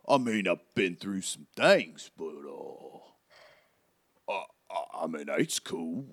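bashful, halting character voice; Hagrid. Acted. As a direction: stumble modestly through it in a put-on accent.

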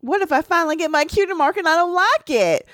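very high anxious filly; panicky filly voice. Acted. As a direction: spiral into high worried questions. Voicing anxious Apple Bloom.

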